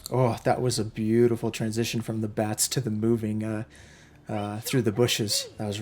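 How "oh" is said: ordinary talk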